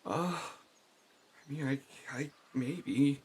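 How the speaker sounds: high, halting, unsure voice; hesitant unicorn. Acted. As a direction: waver reluctantly over an offer.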